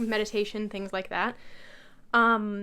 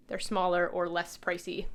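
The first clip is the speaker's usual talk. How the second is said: usual speaking voice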